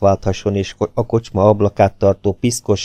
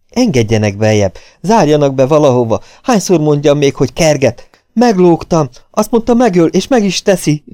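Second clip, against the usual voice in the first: loud pleas climbing higher into panic; desperate begging; 7 semitones higher, 5 dB louder than usual. A narrator's reading. Fugitive begs to be locked away, rising panic. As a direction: plead urgently, building to a high cry. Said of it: "rimádkodott könnyes szemmel Marvel".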